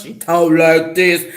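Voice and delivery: big, loud husky imitation. Speaker mocking another girl's big husky voice, husky and loud. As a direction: mimic her big husky voice loudly. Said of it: "she has a husky voice".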